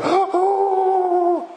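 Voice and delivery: high startled gasp of "Oh!"; mock gasp of shock. Preacher mimicking a fearful congregation, high startled gasp. Congregation reacting to judgment day.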